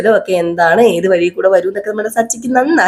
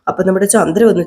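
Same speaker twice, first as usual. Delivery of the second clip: usual speaking voice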